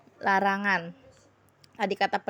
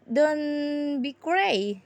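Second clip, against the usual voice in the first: drawn-out command; 4 semitones higher, much slower than usual. Shown as a demonstration. Teacher models a prohibition.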